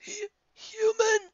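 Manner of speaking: very high, slow wheeze of disbelief; wheezing. Acted. Twilight wheezing in shock at Lyra, very high. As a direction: choke the word out. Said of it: "she wheezed".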